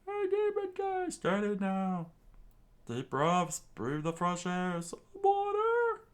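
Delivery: very high, loud squeaky voice; fussy crewmate. A narrator's reading. Cook tending wounded Sanji, high squeaky fuss.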